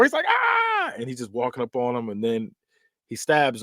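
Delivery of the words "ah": long high-pitched mock scream; drawn-out squeal of mock terror; imitated scream. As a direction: shriek like a trapped victim, acted. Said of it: "he's screaming and trying to open the door".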